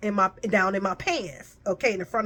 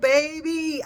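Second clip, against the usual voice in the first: usual speaking voice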